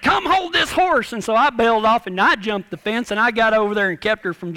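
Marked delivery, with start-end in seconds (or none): 0.0-1.1 retold holler. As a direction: call out for help, higher but not full volume. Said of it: "so I hollered at Gary".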